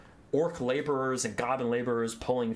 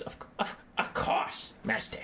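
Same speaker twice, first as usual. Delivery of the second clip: appeasing rat minion. Acted. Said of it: "and goes".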